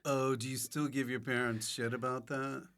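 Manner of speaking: usual speaking voice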